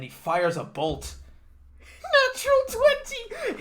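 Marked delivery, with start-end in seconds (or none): 2.3-3.6 high squeal, laughing; excited shout. Real, not acted. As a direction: cheer the lucky roll. Announcing a critical roll.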